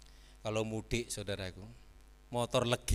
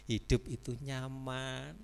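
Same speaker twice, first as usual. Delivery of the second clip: ordinary talk, nothing marked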